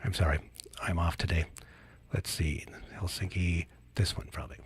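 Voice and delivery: whispered to himself while searching; real whisper. Real, not acted. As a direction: drop to a whisper, talking to yourself. Muttering to himself finding clip.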